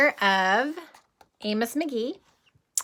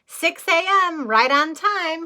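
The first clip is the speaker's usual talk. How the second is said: higher and louder, proud and slow; cheerful reply; 8 semitones higher, 7 dB louder, slower than usual. Acted. Amos answering the bus driver, brightly proud. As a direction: announce it like a punctual man. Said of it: "he'd reply".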